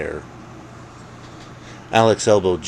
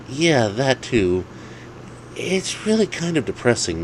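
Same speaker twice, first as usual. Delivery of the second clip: friend's voice; 7 semitones higher than usual. Acted. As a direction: drag it out with mock pity. Friend piling on.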